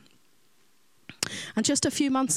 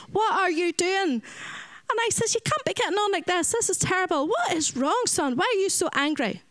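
a raised, pitched-up motherly rebuke; stern scolding; 9 semitones higher than usual. Retold or imitated. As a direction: scold the little boy firmly but kindly. Scolding an angry child.

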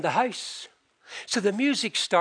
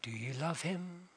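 very soft, intimate appeal; earnest quiet question; 3 semitones lower, 18 dB quieter than usual. Real, not acted. Preacher turning the question to his listeners, hushed. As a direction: ask the congregation gently, almost whispered. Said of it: "That Christ may dwell".

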